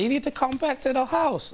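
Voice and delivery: high squeaky girlfriend impression; mock baby mum voice. Retelling her call in a high mocking voice.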